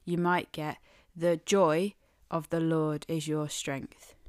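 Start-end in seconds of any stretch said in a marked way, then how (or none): 1.1-4.1 JOY leaned on, then falling; stress on joy. Shown as a demonstration. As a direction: lean into the word joy. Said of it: "emphasize different parts of this phrase".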